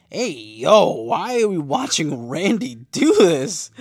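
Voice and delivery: loud, high, drawn-out protest; appalled outcry. Retold or imitated. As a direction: cry out in hammed-up disbelief, stretching the words. Acts out own appalled note.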